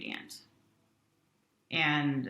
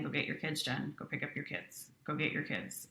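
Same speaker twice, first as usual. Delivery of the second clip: softly imitated nagging inner voice, repeated quietly; persistent inner voice; 2 semitones lower, 8 dB quieter than usual. Inner voice telling a mother to get her kids, softly insistent. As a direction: repeat it softly, like a thought that won't stop. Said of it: "that voice just kept saying".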